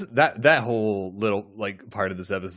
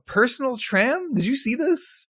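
amused amazement; 12 semitones higher than usual. Real, not acted. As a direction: marvel at the absurd little lift. Amazed at Worf's lift.

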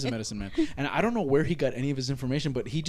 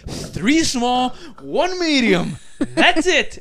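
high, louder, very slow spelling out of the order; exasperated order; 9 semitones higher, 6 dB louder, much slower than usual. Retold or imitated. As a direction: lay out the order like it's obvious. Retold fast-food order argument.

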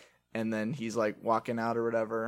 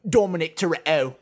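mock British villain on the phone; Deckard Shaw; 4 semitones higher, 9 dB louder than usual. Retelling the villain's phone threat, mock British accent. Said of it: "British accent for Shaw".

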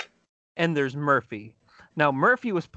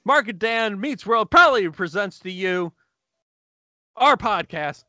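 booming ringmaster announcement; 5 semitones higher, 4 dB louder than usual. Acted.

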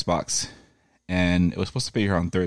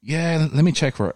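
high, casual clerk voice; mock store clerk; 8 semitones higher than usual. Retelling the Walgreens manager's reply in a mild high voice. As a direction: pitch up for the clerk.

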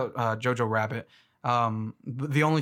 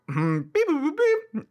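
robot beeps; 13 semitones higher than usual. Retold or imitated. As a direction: beep it out like a cartoon robot. Imitating robot noises.

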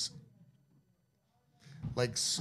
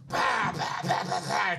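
hammed-up fighting yells; mock battle shouts; 6 semitones higher than usual. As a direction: grunt out each kick as you smash it. Hein kicking in the toilet.

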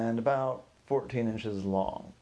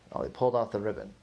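ordinary delivery, nothing marked

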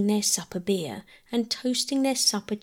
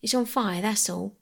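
ordinary delivery

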